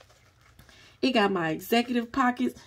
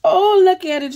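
high, loud burst of delight; excited shout; 8 semitones higher, 11 dB louder than usual. Real, not acted. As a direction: exclaim it at full volume. Loud exclamation over item.

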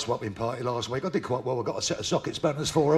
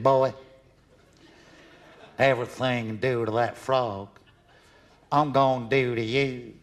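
slow Southern sheriff drawl; 2 semitones lower, slower than usual. Acted. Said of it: "massive redneck sheriff standing there".

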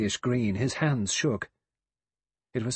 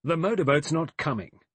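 raised, higher testy voice; testy, impatient; 6 semitones higher, 5 dB louder than usual. A narrator's reading. General breaks into the talk about the motorboat, testy and impatient. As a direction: cut in with loud impatience. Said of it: "a testy, impatient voice".

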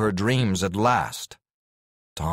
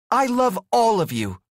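high, raised voice to the group; calling out to all; 4 dB louder than usual. Acted. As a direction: lift it up for everyone to hear. Heroine calls out to everyone.

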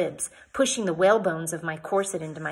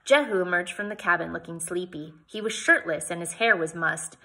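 plain reading voice

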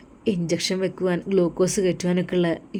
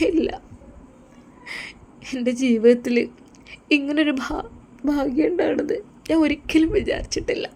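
much higher, teary wife voice; a bride close to tears; tearful young wife; 8 semitones higher than usual. Acted.

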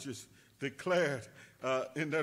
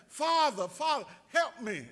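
put-on child crying out; 9 semitones higher, 7 dB louder than usual. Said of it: "he's crying out to his dad".

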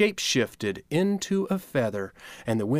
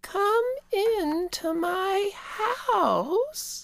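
very high, very slow elder; old grandmother voice; much slower than usual. Acted. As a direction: creak it out like a kindly old spider. Spider Grandmother speaks.

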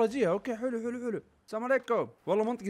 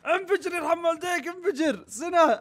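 higher, begging the thing to pop; pleading, excited; 13 semitones higher, 4 dB louder, slower than usual. Real, not acted. Gamer begs the in-game creature to burst, high and pleading. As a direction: come on, burst already.

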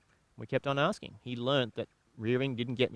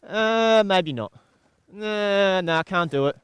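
loud, drawn-out, high-starting refusal; balking horse's thoughts; 7 semitones higher, 9 dB louder, slower than usual. Acted. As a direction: play a horse loudly balking mid-task. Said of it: "I don't want him thinking".

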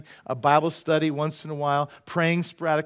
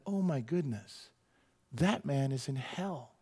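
stunned and sincere; 6 dB quieter, slower than usual. Retold or imitated. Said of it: "And I just went".

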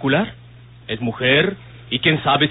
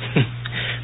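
soft dismissive laugh; 6 dB quieter than usual. Acted. Dismissing her worry.

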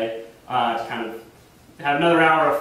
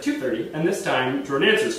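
normal talking voice

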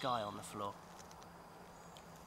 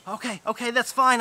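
eager agreement; 7 semitones higher, 9 dB louder than usual. Acted. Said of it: "Osato said".

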